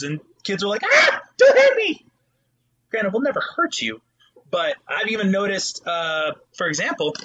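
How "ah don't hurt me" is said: high, loud, squealing plea; hammed-up frightened child yelping; mock terrified. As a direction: shriek it like a scared kid. Kids terrified of teacher.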